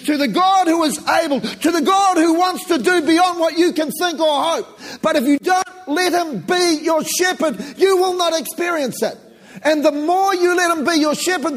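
high, loud, shouted preaching; fiery pulpit cry rising to hallelujah; preaching at full force. Real, not acted. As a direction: lift the whole room with it. Preaching climax on God's promise.

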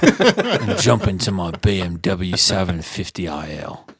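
slow British action-star impression; measured London tough guy; Jason Statham. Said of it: "That's a really good Jason Statham".

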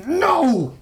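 high, strained refusal; high, drawn-out defiant no; growled back. A narrator's reading. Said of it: "Cronish growled back".

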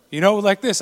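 normal talking voice

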